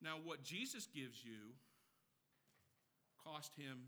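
a reverent near-hush; hushed and solemn. Real, not acted. Solemn drop after loud points.

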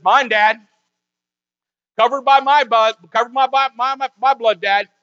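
Jesus answers Satan, loud and firm; bold claim. Pretend. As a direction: proclaim it boldly. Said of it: "Jesus steps up and says".

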